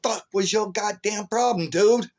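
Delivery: retold outburst, high and indignant; high-pitched, exasperated telling-off; angry confrontation. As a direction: relive chewing him out, pitch way up. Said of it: "I was pissed, man".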